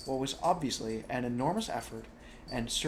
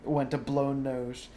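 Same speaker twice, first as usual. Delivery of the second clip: usual speaking voice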